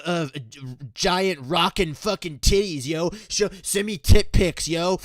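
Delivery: crude harassing gamer. Retold or imitated. Douchey gamer harassing a woman in voice chat, loud pestering. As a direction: imitate an over-eager creep badgering in voice chat.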